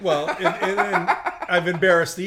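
laughing through the words; sheepish laughing admission. Real, not acted. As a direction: chuckle while you talk. Laughing at his own story.

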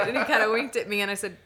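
ordinary talk

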